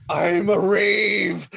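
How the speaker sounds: whooped rave cry. Acted. Player mimicking the stoned gnome, loud drawn-out whoop. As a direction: stretch each word out loudly. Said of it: "He's like".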